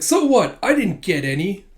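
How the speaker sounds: put-on high, loud kid comeback; cheeky retort. Anya shooting back at Damien, high cheeky retort. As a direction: toss it back like a bratty child.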